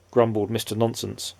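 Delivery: ordinary delivery, nothing marked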